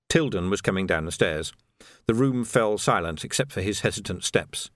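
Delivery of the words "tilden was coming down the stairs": ordinary delivery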